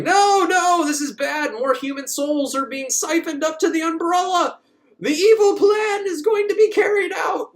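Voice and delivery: high, loud panicked hero voice, ending in a shout; mock alarmed hero. Hero panics over the evil plan, high mock shout. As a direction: ham up the hero's alarm, way up high. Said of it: "he's like".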